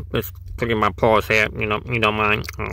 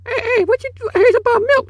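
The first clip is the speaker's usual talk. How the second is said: much higher, louder kitten demanding answers; tiny kitten getting in someone's face over milk; squeaky kitten squabble; 8 dB louder, faster than usual. Acted. Kitten confronting a sibling about milk, squeaky and indignant.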